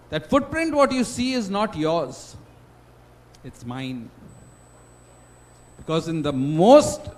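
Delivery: a loud, slow, tender answer from God. Acted. God answering the despairing man, loud then gently slow. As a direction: comfort a child, swelling then gentle.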